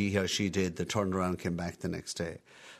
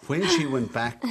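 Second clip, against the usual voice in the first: higher and louder, slow through a laugh; 6 semitones higher, 7 dB louder, slower than usual. Real, not acted. Nephew retelling his aunt's boasting, laughing slowly. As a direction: laugh as you start the line.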